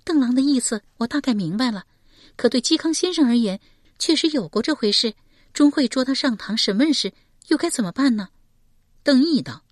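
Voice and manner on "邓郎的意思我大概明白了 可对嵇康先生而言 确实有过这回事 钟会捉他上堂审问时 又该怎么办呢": high-pitched, thoughtful character voice; light, raised register, puzzled; worried questioner. A narrator's reading. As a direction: lift the voice high and raise a concern. Voices Shi Pei questioning.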